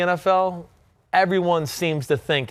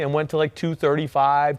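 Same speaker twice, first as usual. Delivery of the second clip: usual speaking voice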